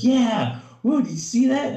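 loud, high-pitched whooping excitement; imitated thrilled kid. Kid to coach after nearly hitting handstand, thrilled imitation.

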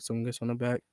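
usual speaking voice

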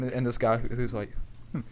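ordinary talk

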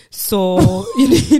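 words breaking into laughter; can't get through it for laughing; laughing mid-sentence at the absurd advice. Real, not acted. Laughing while retelling doctor advice.

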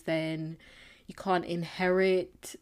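ordinary talk

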